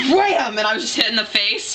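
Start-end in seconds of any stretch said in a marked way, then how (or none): 0.1-0.6 sudden high "wham"; high-pitched impact sound effect; imitated crash. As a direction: blurt a crash noise out of nowhere. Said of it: "all of a sudden".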